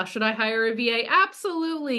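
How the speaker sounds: usual speaking voice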